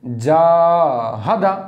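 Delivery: जाहदा stretched very slowly, vowels held long; drawn-out recitation. Shown as a demonstration. Teacher shows students how to recite जाहदा correctly, drawn out. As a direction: hold every vowel of जाहदा long and steady. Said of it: "तो मजहूल से कैसे बचेंगे?".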